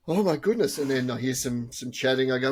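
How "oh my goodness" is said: mock gasp. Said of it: "one of the girls gasps".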